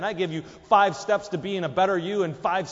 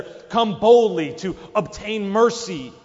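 "Come boldly to obtain mercy" slow and louder, each word pressed; stress on boldly; 7 dB louder, slower than usual. Shown as a demonstration. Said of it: "it sounds more like we're".

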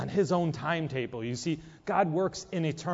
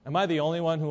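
normal talking voice

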